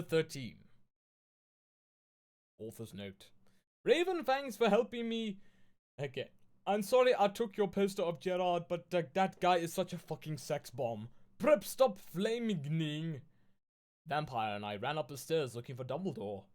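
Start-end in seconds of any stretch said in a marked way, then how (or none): 3.8-5.4 put-on higher, louder valley-girl author read; mock author voice. Streamer reading the author's note to viewers, mock teen-girl voice. 6.6-13.2 put-on higher, louder valley-girl author read; mock author voice. Streamer reading the author's note to viewers, mock teen-girl voice.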